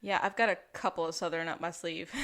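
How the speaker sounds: ordinary delivery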